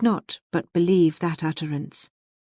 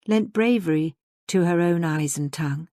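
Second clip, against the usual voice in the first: usual speaking voice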